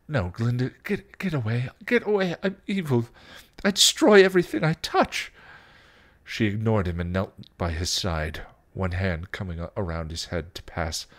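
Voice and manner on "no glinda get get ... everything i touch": high, stammering, frantic warning; panicked Spike. Acted. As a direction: warn them off, building to loud.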